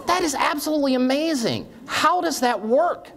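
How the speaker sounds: high-pitched laughing disbelief; amazed man. Acted. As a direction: drag it out, utterly astonished. Man amazed in joke punchline.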